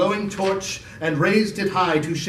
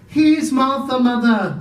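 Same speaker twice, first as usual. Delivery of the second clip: put-on higher, louder girl's voice; a young daughter calling out; cheerful girl; 7 semitones higher, 4 dB louder than usual. Martha announces herself.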